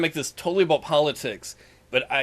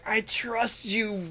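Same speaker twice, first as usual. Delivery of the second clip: slow mock; 5 semitones higher, 6 dB quieter, much slower than usual. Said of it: "You're like".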